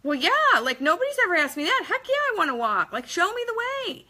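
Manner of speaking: a man thrilled someone finally asked him; an overjoyed patient saying yes to walking; eager excitement. Retold or imitated. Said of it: "He was like".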